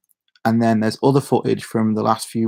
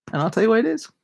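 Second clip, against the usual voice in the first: teasing promise; 11 semitones higher, faster than usual. Acted.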